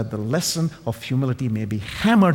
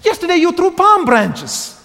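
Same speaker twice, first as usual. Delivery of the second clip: much higher and louder cry; shouting; 6 dB louder than usual. Acted. Ignored donkey shouting at the market crowd, loud and high.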